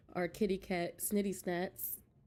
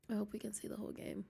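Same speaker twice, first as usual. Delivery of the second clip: whisper; 8 dB quieter, much faster than usual. Real, not acted. Whispering while opening game.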